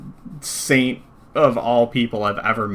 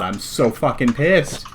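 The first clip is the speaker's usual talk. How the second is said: ordinary talk, nothing marked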